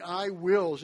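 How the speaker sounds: WILLS punched loud; stressed I wills. Shown as a demonstration. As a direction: lean hard on "I wills". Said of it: "I emphasized them as I read them".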